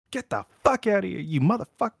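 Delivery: high, fast mock tirade; going off angrily. Guy going off on Wayne, angry. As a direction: imitate someone flying off the handle. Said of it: "just goes off on him".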